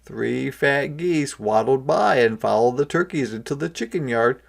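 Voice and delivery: ordinary delivery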